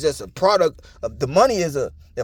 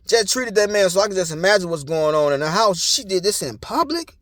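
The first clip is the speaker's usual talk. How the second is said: ordinary talk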